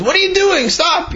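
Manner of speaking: sharp high cry to stop him; alarmed shout. Acted. Brother stopping him from boarding the wagon, alarmed high shout. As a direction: yell to stop him in his tracks.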